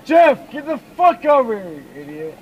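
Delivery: loud call across the street; yelling. Real, not acted. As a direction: shout to him from afar.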